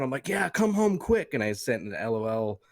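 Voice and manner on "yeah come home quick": high, drawn-out joking call; joking mock urgency. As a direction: playfully fake the urgency, pitched up and slow. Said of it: "I was joking around".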